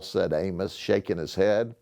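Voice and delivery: ordinary delivery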